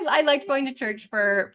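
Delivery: usual speaking voice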